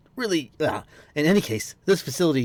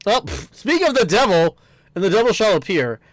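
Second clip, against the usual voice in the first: teasing; 6 semitones higher, 5 dB louder than usual. Acted. Reading game character's teasing line.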